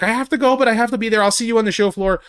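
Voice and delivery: imitating her cheerful hurried exit; high bubbly see-you-later; hurried goodbye. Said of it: "she's like".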